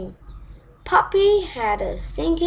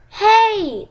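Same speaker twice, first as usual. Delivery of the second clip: high, louder call to someone leaving; calling after; 9 semitones higher, 4 dB louder than usual. Acted. Frog calling after the princess running off, loud high call. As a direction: call out after her as she runs. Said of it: "the frog called after her".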